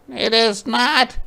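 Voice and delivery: high, slow stubborn no; a child insisting it's hers; high-pitched stubborn little girl; girl's indignant denial. Acted. Ruthie quarrel.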